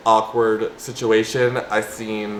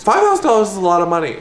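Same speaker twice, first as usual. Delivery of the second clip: higher, rushed and emphatic; excited; 7 semitones higher, much faster than usual. Real, not acted. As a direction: blurt it out, tempted. Excited repeat to viewers.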